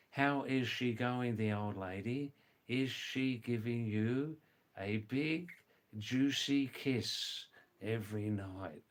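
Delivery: slow, carefully separated words; measured, word-by-word teaching delivery; slow full version. Shown as a demonstration. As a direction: spell it out for a learner, pausing between phrases.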